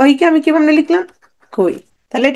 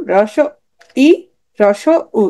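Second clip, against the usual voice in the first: ordinary talk, nothing marked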